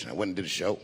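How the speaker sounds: ordinary talk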